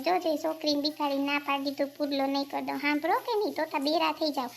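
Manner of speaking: usual speaking voice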